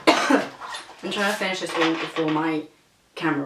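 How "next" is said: normal talking voice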